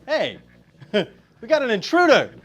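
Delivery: loud laughing shout of surprise; excited shout. Real, not acted. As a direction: call out the intruder, laughing. Intruder appears, calling out.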